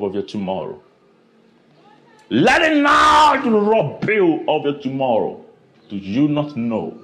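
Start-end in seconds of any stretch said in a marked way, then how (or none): 2.3-5.4 preaching shout. Real, not acted. Preacher pleading with the congregation at full volume. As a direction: drive the appeal home at full force. Climax of sermon appeal.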